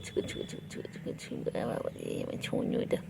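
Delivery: hushed breathy whisper; whispering over a crib. Real, not acted. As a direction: whisper it softly. Whispering to pet. (দোলনার পাশে ফিসফিস করে)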